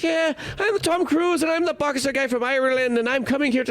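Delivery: mock Irish accent, pitched high; hammed-up high Irish brogue; Tom Cruise, Irish accent. Mocking an actor's attempt at an Irish boxer, bad Irish accent. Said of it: "we don't like that Irish accent".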